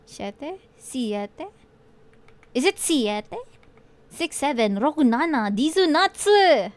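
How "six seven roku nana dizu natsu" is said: building count. Real, not acted. Streamer counting in Japanese, getting louder and higher. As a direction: count up with rising excitement.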